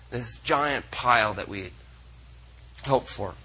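tired voice giving out, fading. Real, not acted. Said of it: "my voice is giving out".

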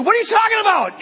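high, fast, loud protest; incredulous outburst. Retold or imitated. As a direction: fire it back in disbelief. Arguing with nephew in bathroom.

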